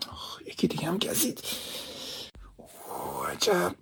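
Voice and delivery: hushed whisper, feeling his way through a dark house; whispering to himself. Acted. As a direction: murmur each step under your breath, slow. Bitten by mosquitoes in the dark.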